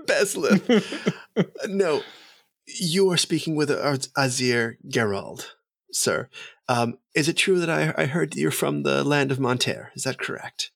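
high, eager noble voice; eager courtly elf. Acted. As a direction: gush politely at the emissary.

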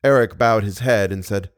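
ordinary delivery, nothing marked

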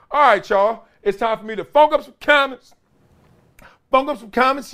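high, lively announce; host segment intro. Acted. Show host introducing the comments segment, lively announce.

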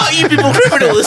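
confused mock question. Reacting to the film's voiceover, mock confused. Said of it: "I was very confused by that statement".

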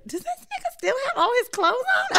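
high-pitched, laughing mock question; laughing quote. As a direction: squeal it out through laughter. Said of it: "because he said".

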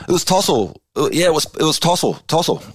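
imitating Finner caught out, squeaky; flustered teammate. Finner grabbed by the jumper, blurting a name, high and flustered.

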